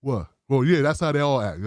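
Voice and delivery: low, unimpressed imitation; mock unsurprised.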